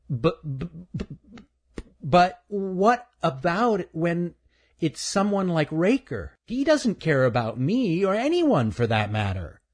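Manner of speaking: put-on a hurt child stammering his objection; stuttering boy. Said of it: "Stuttering Stan".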